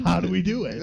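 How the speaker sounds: mock helpless panic. Hotel guests stuck at the door, mock panic. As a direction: play people stumped by a door.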